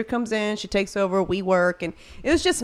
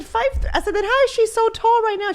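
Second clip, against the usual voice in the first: much higher, loud indignant disbelief; shocked; 14 semitones higher, 4 dB louder than usual. Retold or imitated. Mom questions the pediatrician's height guess, shocked and high. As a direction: cut in outraged and stunned.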